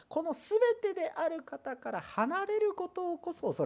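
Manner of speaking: high, slow pleading stress; earnest pulpit urging; emphatic exhortation. Acted. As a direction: plead with them to fear leaving him.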